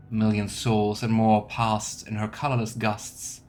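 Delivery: usual speaking voice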